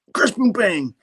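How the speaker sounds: high, punchy comic-book crash; crash effects. Acted. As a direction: play the impact as sound-effect words. Imitating crash through wall.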